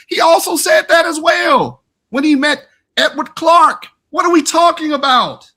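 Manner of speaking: nearly shouting, pitch way up; heated shout. Real, not acted. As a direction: debater shouting down his opponent. Heated debate rebuttal, near shouting.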